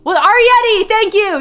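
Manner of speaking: high, loud relieved thanks; excited. Real, not acted. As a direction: cheer the name out. Chat gives the name.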